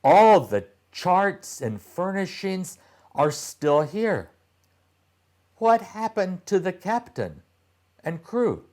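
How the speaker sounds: put-on a boy's voice pitched well up, eager; curious boy. A narrator's reading. Boy Titus exploring the ship, eager and high. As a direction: ask it like an excited little boy. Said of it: "said Titus".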